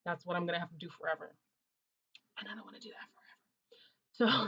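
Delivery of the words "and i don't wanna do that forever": hushed, breathy confession; whispered; whispered aside. Real, not acted. Whispered aside about reviews.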